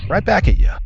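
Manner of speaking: high, loud taunt; taunting shout. Acted. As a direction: fling it back with a shout. Hero turns the attack back.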